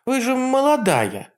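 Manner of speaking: put-on high chirpy girl voice; chirping. A narrator's reading. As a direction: chirp it brightly. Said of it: "чирикнула Василиса".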